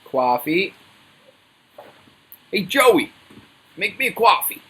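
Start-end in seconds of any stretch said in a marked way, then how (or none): 2.5-4.6 higher, loud put-on American accent. Shown as a demonstration. Ordering Joey to make coffee, in a loud put-on American accent. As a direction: bark it out in a brash American accent. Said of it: "That's my American accent".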